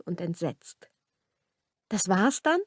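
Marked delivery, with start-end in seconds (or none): 1.9-2.6 helpless questions rising at the end; helpless call. A narrator's reading. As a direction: ask after someone leaving, at a loss. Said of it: "rief sie ihm hilflos nach".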